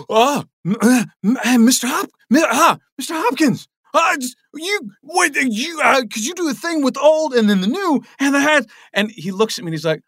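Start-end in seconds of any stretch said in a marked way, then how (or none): 0.1-8.7 stammering nervous fan, very high and louder; gibbering starstruck fan. Retold or imitated. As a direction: babble like you just met your hero and can't form words. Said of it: "just a gibbering idiot".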